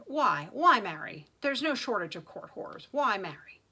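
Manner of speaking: loud, anguished challenge; angry demand. A narrator's reading. Son confronting his father, angry loud demand. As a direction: hammer the question out loudly.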